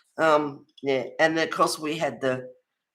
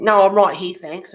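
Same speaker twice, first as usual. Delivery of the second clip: imitated curt brush-off, very loud opening; mimicking a terse, forceful no-thanks; pointed refusal; 3 semitones higher, 8 dB louder than usual. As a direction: punch the refusal, then drop the thanks right down. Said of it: "it was the way she said it".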